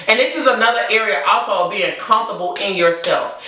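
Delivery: ordinary talk, nothing marked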